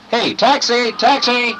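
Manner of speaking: calling out loud and high; hailing a taxi. Shown as a demonstration. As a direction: shout to flag down a cab. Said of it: "I'll try to get this taxi to stop".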